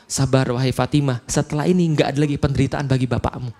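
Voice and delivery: calm her tears with a soft, low voice; gentle comfort. Acted. The Prophet softly comforting his weeping daughter, low and gentle.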